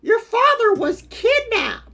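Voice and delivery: high, urgent announcement of bad news; alarmed. Game line read aloud, urgent.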